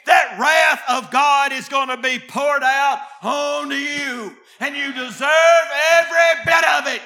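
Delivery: loud, insistent pulpit cadence; full-force preaching. Real, not acted. Preacher warning the sinner of God's wrath, full-force preaching. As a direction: drive each phrase home to the congregation.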